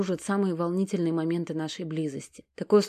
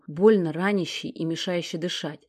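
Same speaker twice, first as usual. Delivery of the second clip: plain reading voice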